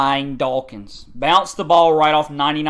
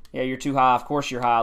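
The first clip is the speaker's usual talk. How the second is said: ordinary delivery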